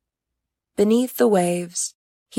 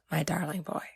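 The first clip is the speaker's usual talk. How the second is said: softer, whispered teasing endearment; mock-tender whisper; 11 dB quieter than usual. Uma teasing Oriaku fondly, in a whisper. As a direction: whisper it like a fond joke.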